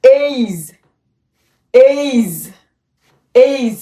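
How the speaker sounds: এইজ said with a long ei sound; teacher modelling a word for students to copy; drilled pronunciation. Shown as a demonstration. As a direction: repeat এইজ clearly for learners. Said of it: "ওর উচ্চারণ হবে".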